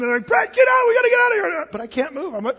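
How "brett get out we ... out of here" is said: high, loud frantic shouting; panicked kids. Retold or imitated. Kids to stuck pastor with bat loose, panicked shouting. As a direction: yell it like kids desperate to escape. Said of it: "the kids are freaking out".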